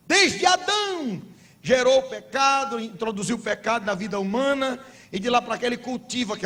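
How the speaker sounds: high, forceful preacher's cry; preaching at full force. Acted. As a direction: pastor drives the point home to the congregation.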